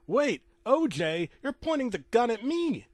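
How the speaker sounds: high, loud cry of alarm; acted panic at being aimed at; alarmed plea. Cameraman alarmed at gun pointed.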